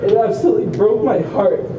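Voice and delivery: usual speaking voice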